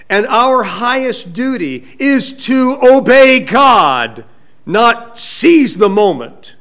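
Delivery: loud, high, slow and weighty; emphatic preaching. Real, not acted. Preacher tells congregation their highest duty, emphatically. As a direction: hammer it home word by word, slowly. Preacher drives home the main point.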